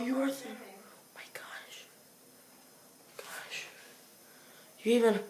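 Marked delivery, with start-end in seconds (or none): 0.0-3.7 frightened whisper. Real, not acted. Hushes after noise.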